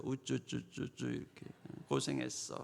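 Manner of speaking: put-on soft, baby-talk comforting; tender cooing. God hugs a child who has suffered, soft cooing. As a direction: coo gently as if cradling a child. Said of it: "그래도 안아주세요".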